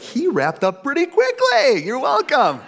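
high, loud, drawn-out playful exclamation; mock delight. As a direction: crow it in hammed-up delight. Voicing relieved watch-checkers, then answering.

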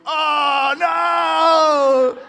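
high, loud hammed-up cry of despair; mock wail. Kids realising dad tricked them, hammed-up loud wail. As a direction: wail at the dashed hopes.

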